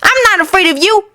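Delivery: put-on high boyish voice, snapping back; a small boy standing up to bullies; young Anakin snapping defiantly at the bullies; defiant snap. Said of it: "he snapped".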